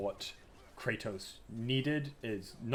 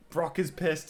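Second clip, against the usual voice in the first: normal talking voice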